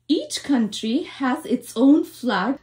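usual speaking voice

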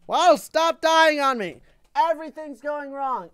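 high, loud complaint; high wail, easing off in volume; exasperated outburst. Real, not acted. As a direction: cry out in frustration at your dying defenses. Plant dying in game.

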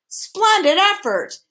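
high, drawn-out hearty praise; loud, jolly congratulation; jovial commendation. A narrator's reading. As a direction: cheer it jovially, stretching the words. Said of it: "commended the humbug jovially".

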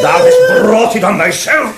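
hammed-up scolding. Toad lording it over washerwoman.

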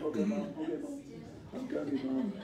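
put-on high, soft kid answering mom; meek kid reply. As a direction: answer mom like a caught child, softly. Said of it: "I'm like".

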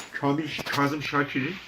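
ordinary talk, nothing marked

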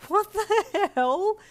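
drawn-out, high, loud cry; laughing yell. A narrator's reading. Player under a hail of snowballs, laugh-yelling. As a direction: yell it slowly in amused disbelief. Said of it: "you laugh yelled".

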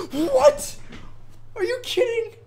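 shrieking, very high and loud; frustrated disbelief. Real, not acted. Gamer robbed of a landed trick, shrieking in disbelief. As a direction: scream in disbelief at the game. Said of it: "that's so frustrating".

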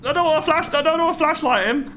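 high, frantic babble; panicked. Real, not acted. Gamer fleeing the monster, babbling high in panic. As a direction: blurt it out in fear.

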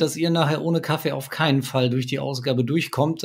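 usual speaking voice

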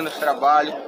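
normal talking voice

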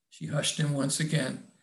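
ordinary delivery, nothing marked

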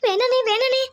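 high-pitched, urgent begging; panicked pleading. Acted. Lakshmi begging her brother not to come along, panicked. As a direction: beg him frantically to stop.

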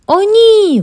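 high, loud cry of dismay; loud panicked oh no; alarmed exclamation. A narrator's reading. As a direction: cry out as everything goes wrong. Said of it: "вигукнула Ві".

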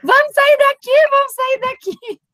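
grito agudo e alarmado, imitando alguém; imitação de gritos. Retold or imitated. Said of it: "chegou a Cláudia gritando". (imitando gritos agudos de alerta)

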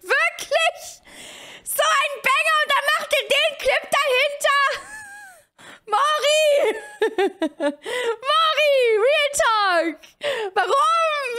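high, squealing disbelief; hammed-up shocked protest; mock outrage. Real, not acted. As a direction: cry out why in high disbelief. Outraged at the clip.

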